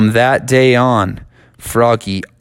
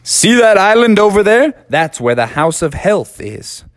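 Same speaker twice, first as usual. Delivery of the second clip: higher, louder cartoon voice; character pointing; 9 semitones higher, 4 dB louder than usual. Guide showing Froggy the island, higher louder cartoon voice. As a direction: point it out eagerly.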